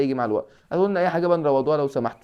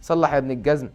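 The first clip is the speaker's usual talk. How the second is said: normal talking voice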